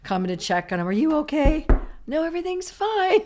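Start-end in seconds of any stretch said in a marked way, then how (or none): 0.9-1.7 high, loud voice calling through a door; concerned call. Retold or imitated. People at the door call to a bleeding man, high and loud. 2.1-3.2 much higher, louder fake-bright voice; fake cheerful denial. A man covered in blood calls back to the people at the door, high and fake-cheerful. Man hiding suicide attempt.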